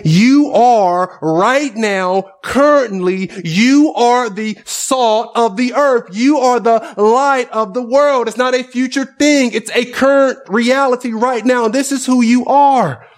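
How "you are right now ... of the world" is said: slow emphatic quote. Retold or imitated. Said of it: "He's saying".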